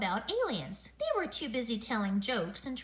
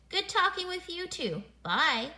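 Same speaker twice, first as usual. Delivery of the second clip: higher, upbeat phone goodbye; cheery sign-off; 7 semitones higher, 3 dB louder than usual. A narrator's reading. Mother ending a phone call with another mom, cheery sign-off. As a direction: sing out a friendly goodbye.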